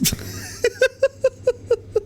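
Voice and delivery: long high giggle; high-pitched rippling laugh; laughter. Real, not acted. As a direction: laugh at your own slip. Laughs at own mistake.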